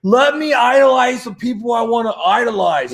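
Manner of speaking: hammed-up indignant shout; mock rant. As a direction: rant at the critics, hamming it up. Mock rant against critics.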